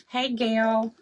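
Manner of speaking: usual speaking voice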